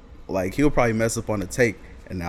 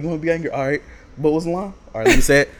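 louder, higher mock anger; mock angry; 5 semitones higher, 8 dB louder, faster than usual. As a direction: put on a loud fake anger. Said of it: "And he was like".

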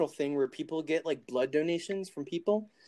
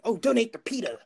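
higher mock-earnest voice; mocking naive donor; 5 semitones higher than usual. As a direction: parody a gullible fan of the charity. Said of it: "people saying".

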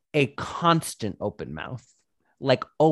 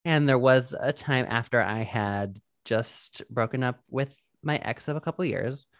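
ordinary talk

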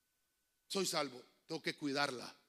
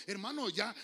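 ordinary talk

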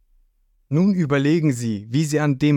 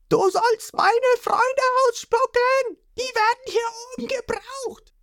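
put-on furious boy hero. Ruffy beating the whale to free his friends, high frantic rant.

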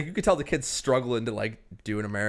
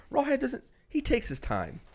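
usual speaking voice

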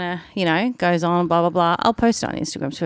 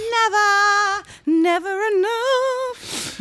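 very high, drawn-out dog whine; dog Jim's voice; 12 semitones higher, much slower than usual. Acted. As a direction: wail it slowly in a high pet-dog voice. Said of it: "That's Jim".

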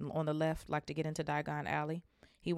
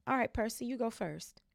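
higher, brisk motherly voice; mother directing; 6 semitones higher than usual. Acted. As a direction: send the boy off. Mother sending son through.

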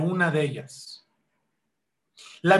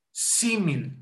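"Símil" with the first i stressed, pitch falling away; stress on first syllable; 4 dB quieter than usual. Shown as a demonstration. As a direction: demonstrate "Símil", leaning on SÍ. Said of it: "Lleva acento en la primera I". ("Símil" acentuado en la primera sílaba)

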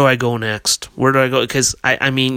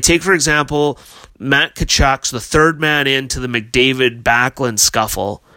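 usual speaking voice

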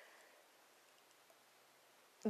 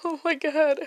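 half laugh, half cry, voice breaking; laughing through rising tears; getting emotional; 6 semitones higher, 3 dB louder than usual. Real, not acted. To the viewers, overcome and tearful. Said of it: "and I'm getting emotional".

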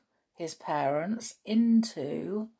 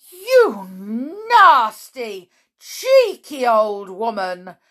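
loud scolding, starting high; angry shout; 4 semitones higher, 10 dB louder, slower than usual. A narrator's reading. Mr Jenkins rounds on grandmother, shouting. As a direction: shout the insult with emphatic, separated words. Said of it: "shouted Mister Jenkins".

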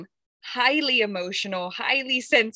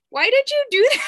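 a sudden loud, high cry of disbelief at herself; half-laughing outburst at her own foolishness, much higher and louder; exasperated self-scolding; 13 semitones higher, 8 dB louder, faster than usual. Retold or imitated. Scolding herself, retold in the moment.

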